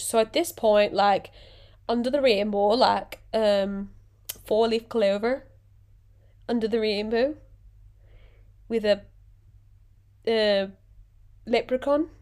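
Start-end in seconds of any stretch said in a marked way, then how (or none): 1.9-12.1 badly faked Irish accent; halting attempt at an Irish accent; mock Irish accent. Vlogger apologising to Irish listeners, mock Irish accent. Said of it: "If you're actually Irish listening to this".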